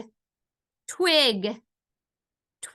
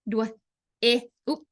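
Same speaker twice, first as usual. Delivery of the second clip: ordinary talk, nothing marked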